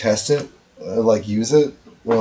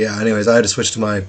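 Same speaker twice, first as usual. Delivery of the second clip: usual speaking voice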